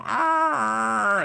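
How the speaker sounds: high strained rooster cry; a feeble squawk from the rooster; sick rooster squawk. Retold or imitated. Rooster Pete answering the DJ, high squawk. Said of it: "And Pete would go".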